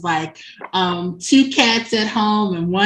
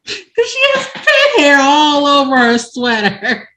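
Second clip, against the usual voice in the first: high, loud, breaking up with laughter; laughing; 7 semitones higher, 6 dB louder than usual. Real, not acted. Storyteller revealing the pet hair punchline, laughing. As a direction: squeal the punchline through laughter. Cracks up at her own punchline.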